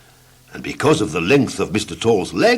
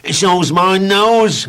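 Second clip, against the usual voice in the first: slow, high, louder amused voice; laughing Mister Nosy; 10 semitones higher, 5 dB louder, much slower than usual. Acted. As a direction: gloat over the nose, drawn out. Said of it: "replied Mister Nosy, laughing".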